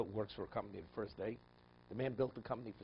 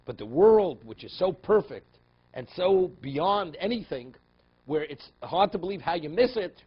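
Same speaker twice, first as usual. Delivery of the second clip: loud, slow, high preaching; preaching at full force; 7 semitones higher, 13 dB louder, slower than usual. Acted. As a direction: hammer the point home slowly. Preaching climax.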